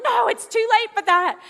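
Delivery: loud, high bratty refusal; acted-out sulky shout. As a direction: throw dad's offer back at him. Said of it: "I was being a brat".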